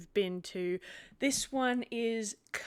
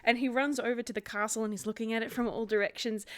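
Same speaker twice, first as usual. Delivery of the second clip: ordinary delivery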